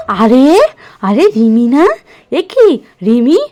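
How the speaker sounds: loud surprised greeting. Acted. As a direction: exclaim on recognising a guest. Surprised to see Rimi. (অবাক হয়ে জোরে ডাক)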